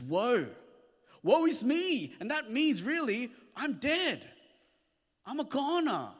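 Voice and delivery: high, loud, intense preaching; preaching at full force. Acted. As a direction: drive the climax home from the pulpit. Preaching Isaiah's cry.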